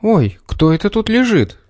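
high, startled exclamation; mother startled by the dead fox; exclaiming. A narrator's reading. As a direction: gasp at what's on the floor. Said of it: "воскликнула мама".

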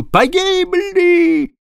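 long, very high drawn-out wail; first mate howling in panic. A narrator's reading. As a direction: howl it in total despair. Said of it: "буквально взвыл".

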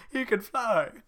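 squeaky very high piggy shout; Three Little Pigs. Acted. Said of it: "Three Little Pigs".

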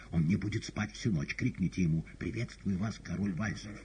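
ordinary delivery, nothing marked

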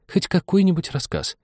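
high-pitched pleading for a story; very humble. A narrator's reading. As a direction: beg meekly in a high voice. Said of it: "очень смиренно".

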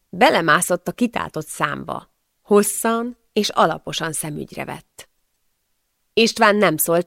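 usual speaking voice

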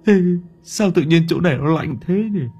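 hushed, frightened stammer; trembling prayer. Acted. Hải praying alone in the dark garden, frightened. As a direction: pray in fear. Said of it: "chân tay nó run cầm cập".

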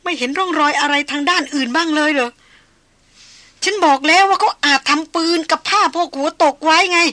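loud, high-pitched arguing, building; desperate insistence. A narrator's reading. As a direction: argue frantically against the bad news. Woman argues he must have escaped.

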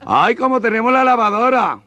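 high, loud camp voice. Acted. Camp shopper to the cashier, high loud voice.

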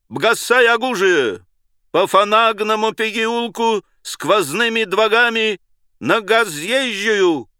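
high, loud, slow rolling burr on every r; burred commanding howl. A narrator's reading. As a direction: bellow orders with a guttural French r. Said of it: "он взвыл команду необычным, неслыханным картавым голосом". (картавый командный вой)

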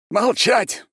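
very high, loud order; furious command. A narrator's reading. Mad ex-emperor silences the prisoners, shouting. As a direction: cut them off with a shout.

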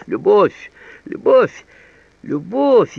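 loud, high cries, climbing with each repeat; пылкое восклицание. A narrator's reading. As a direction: throw the word out as an impassioned, rising outcry. Roger rants about love. (пылкие, громкие восклицания высоким голосом)

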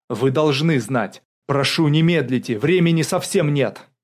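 loud, urgent appeal; hurried plea. A narrator's reading. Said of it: "торопливо добавил Александр Дубко". (торопливо, настойчиво)

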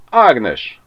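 loud, high call to someone off; hollering. A narrator's reading. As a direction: holler the name out. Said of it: "kurjantott Ribiszke". (nagyot kurjantva)

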